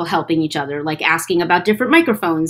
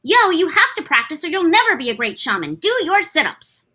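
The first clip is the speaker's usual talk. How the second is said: put-on higher bossy girl voice; Anna voice; 8 semitones higher than usual. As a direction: boss them around, pitched up. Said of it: "Anna in, from Shaman King".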